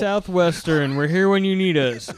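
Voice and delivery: CMの宣伝ナレーター風に; 病院広告をふざけて読み上げる; mock commercial announcer. Mock hospital ad slogan.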